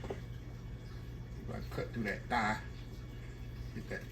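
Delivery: slow, soft whisper. Real, not acted. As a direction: whisper while carving carefully. Focused carving close to mic.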